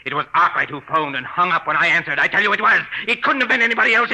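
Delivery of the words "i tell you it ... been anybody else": desperate shout. Acted. Husband insists desperately.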